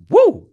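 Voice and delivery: excited woo. Retold or imitated. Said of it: "Saka's like".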